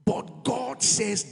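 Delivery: drawn-out stress on God; slow, weighty "God"; slow emphatic. Acted. Preacher builds to quote.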